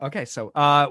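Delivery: ordinary talk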